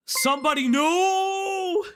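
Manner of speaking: a playful yelp of protest; mock cry of no. Streamer yelping at his own joke.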